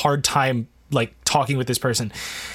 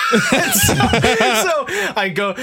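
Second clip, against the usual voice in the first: laughing; 4 dB louder, much slower than usual. Real, not acted. Reacting to absurd translation.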